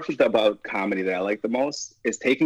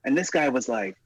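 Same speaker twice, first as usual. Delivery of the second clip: ordinary talk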